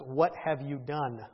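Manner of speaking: low, slow, grave reproach; stern Samuel. Acted. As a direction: confront him quietly with deep, measured disapproval. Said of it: "Samuel arrives and says".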